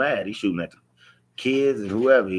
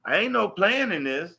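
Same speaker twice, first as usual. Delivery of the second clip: retold yell; 11 semitones higher than usual. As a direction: shout it up high, desperate and pleading. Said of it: "I'm, I'm yelling at her".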